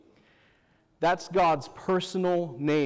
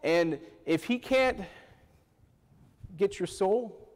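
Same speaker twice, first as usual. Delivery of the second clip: normal talking voice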